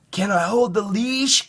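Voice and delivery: put-on high, hopeful child asking; little boy voice. A narrator's reading. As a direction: ask like an eager little boy. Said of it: "Caillou asked Grandma".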